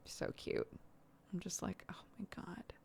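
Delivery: unvoiced whisper of delight; whispered gush. Real, not acted. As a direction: breathe it out, overwhelmed. Melting over the child.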